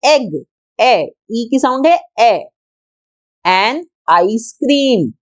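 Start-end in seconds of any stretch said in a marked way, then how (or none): 3.5-5.1 An ice cream stretched very slowly, the ice opening with a long eye sound; slow teaching demo. Shown as a demonstration. Teacher modelling the eye vowel for students, very slow. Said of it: "आई की sound है आई".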